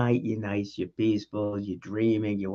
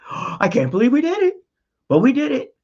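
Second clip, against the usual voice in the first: much higher and louder giddy disbelief; thrilled disbelief; 13 semitones higher, 6 dB louder than usual. Retold or imitated. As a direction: burst out like you can't believe it worked. Reliving project success with gasp.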